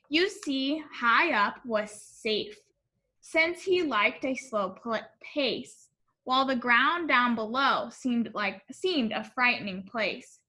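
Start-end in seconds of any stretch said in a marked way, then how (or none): none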